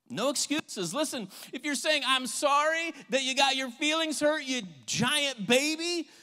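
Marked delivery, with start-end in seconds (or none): 2.1-6.1 high, loud mocking non-apology; insincere apology. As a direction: throw out a scornful, exaggerated sorry. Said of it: "that's not an apology".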